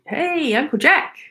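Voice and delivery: high, drawn-out hailing call without shouting; called-out greeting. Shown as a demonstration. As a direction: show how to greet someone in the street. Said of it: "if you ever see him, say".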